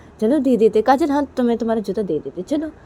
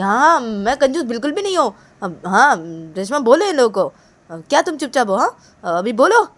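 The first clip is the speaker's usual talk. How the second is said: loud, raised-pitch insistence; angry shouting; 5 semitones higher, 5 dB louder than usual. Acted. Kajal pressing Reshma to speak up for her, angry. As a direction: demand backing in an offended, loud voice. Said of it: "चिल्ला क्यों रही हो इतना".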